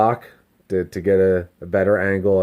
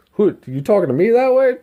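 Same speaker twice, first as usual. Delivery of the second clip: high, rising challenge; mock indignation; 12 semitones higher, 4 dB louder than usual. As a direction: act out an offended ego. Acts out his offended ego.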